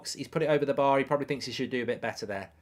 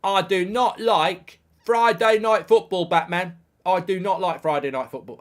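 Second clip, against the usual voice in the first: loud, raised declaration to Batman; mock movie declaration; 5 semitones higher, 7 dB louder than usual. Football streamer playfully addressing Batman, loud mock declaration.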